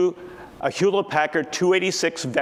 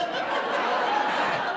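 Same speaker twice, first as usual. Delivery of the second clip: breathy laugh. Real, not acted. After punchline of letter.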